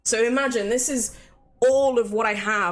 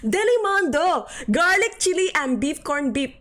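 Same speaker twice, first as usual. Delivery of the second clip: put-on high, loud Filipino-accent ad voice; 6 semitones higher, 4 dB louder than usual. Streamer redoing the mock advert, Filipino accent. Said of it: "That was my Filipino accent".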